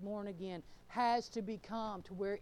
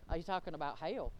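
normal talking voice

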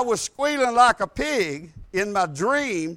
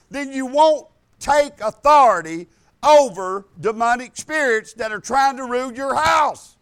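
raised, forceful preacher's delivery; preaching; 4 semitones higher, 5 dB louder than usual. Real, not acted. Preacher urging listeners to take authority, forceful preaching. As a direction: drive each phrase home from the pulpit.

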